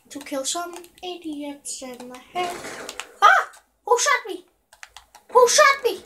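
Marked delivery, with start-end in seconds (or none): 3.2-6.0 surprised yell. Real, not acted. Getting shot in game.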